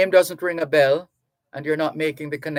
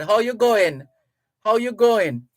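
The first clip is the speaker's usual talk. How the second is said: Trinidad lilt, pitched up and louder; Trinidadian greeting; 6 semitones higher, 4 dB louder than usual. Retold or imitated. Radio host showing a Trinidad greeting, Trinidadian lilt. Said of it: "As they say in Trinidad".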